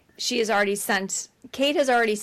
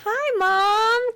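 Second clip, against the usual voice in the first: very high, louder, drawn-out hello; cheery phone greeting; 13 semitones higher, 5 dB louder than usual. Retold or imitated. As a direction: imitate a daughter greeting her mom too cheerily. Imitates Kate answering mom's call.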